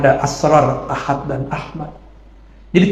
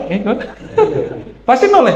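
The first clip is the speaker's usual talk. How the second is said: ordinary talk, nothing marked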